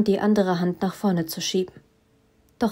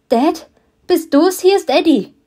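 much higher and louder, panicked cry to Dad; faked panic; 9 semitones higher, 7 dB louder than usual. A narrator's reading. Daughter fakes panic on the phone to her father. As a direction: play up the panic on purpose. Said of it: "meine Stimme panisch klingen zu lassen".

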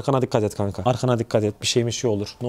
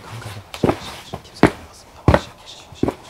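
urgent hushed whisper, no voice at all; faster than usual. Real, not acted. To his friend while sneaking past dogs, a tense whisper. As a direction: breathe the words so the dogs won't hear.

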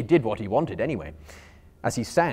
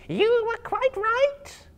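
high, very slow, louder spider voice; 5 dB louder, much slower than usual. Acted. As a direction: spiders laughing at their fallen friend. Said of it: "they said".

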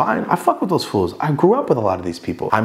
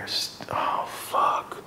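breathed curse of realization; dismayed whisper; 10 dB quieter, much slower than usual. Retold or imitated. Man realizing his friends stand behind him, dismayed whisper. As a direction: whisper it as it sinks in.

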